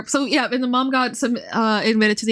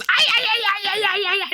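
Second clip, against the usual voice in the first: high frantic ay ay ay, fading; 8 semitones higher than usual. Retold or imitated. Trainer nurse catching a mistake, high yelps fading. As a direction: yelp it, then let it fade. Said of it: "He goes".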